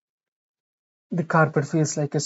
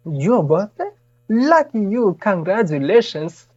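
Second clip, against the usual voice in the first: higher, brightly pitched receptionist greeting; cheery clerk; 5 semitones higher, 3 dB louder than usual. Acted. Receptionist congratulating the lost young man, cheerily. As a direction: chirp it like a delighted desk clerk.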